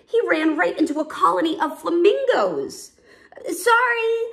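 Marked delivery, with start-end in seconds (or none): 3.5-4.3 high, loud, hasty call; hollered apology. A narrator's reading. Cub fleeing startled flamingos, hollering. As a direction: holler it over your shoulder. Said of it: "he hollered".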